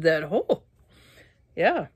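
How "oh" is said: usual speaking voice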